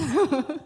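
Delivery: a quick real laugh at her own joke; short laugh. Real, not acted. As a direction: laugh it off lightly.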